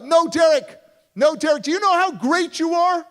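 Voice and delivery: high, full-force shouting; loud, desperate-sounding plea at the top of the voice; screaming counselor. Retold or imitated. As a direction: scream it while backing away. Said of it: "she's screaming at me".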